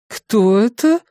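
high-pitched colleague character voice; high, questioning coworker; colleague's voice from behind. A narrator's reading. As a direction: voice a nosy colleague, pitched well up. Colleague surprises her from behind.

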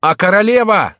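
high, loud call; shouting after someone. A narrator's reading. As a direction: call after a departing rider. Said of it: "закричал ему вслед Ламоль".

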